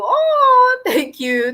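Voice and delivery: high, drawn-out grateful coo; touched. Real, not acted. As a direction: let out a high sound of being moved. Touched by viewer's compliment.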